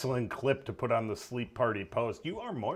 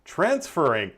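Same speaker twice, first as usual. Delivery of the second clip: GPS voice; 7 semitones higher, 8 dB louder, slower than usual. Retold or imitated. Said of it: "do a G P S voice like that".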